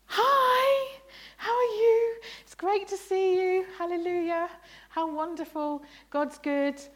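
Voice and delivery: high, bright mock cheer, way over the top; hammed-up holy greeting to an office colleague; gushing churchy cheer. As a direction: greet the colleague like a beaming saint. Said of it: "just pretending".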